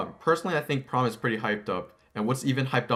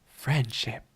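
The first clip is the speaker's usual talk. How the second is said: breathy whispered punchline. Acted. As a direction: whisper it for the joke.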